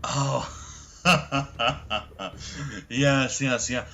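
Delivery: mocking captive. Captive gloats to Faulkner about his burnt ship, smirking laugh. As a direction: savour the bad news with a smirk.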